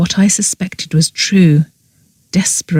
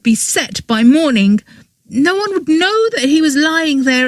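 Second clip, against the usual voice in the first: higher, bitter tirade; higher girl voice, bitter and defiant; angry confession; 7 semitones higher than usual. A narrator's reading. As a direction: throw it all at him, pitched up. Girl's angry explanation of the murder.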